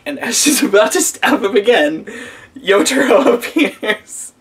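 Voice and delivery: high-pitched, telling it through laughter; laughing through words. Real, not acted. Reviewer retelling an absurd rescue to viewers, laughing through words. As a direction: crack up while narrating the scene.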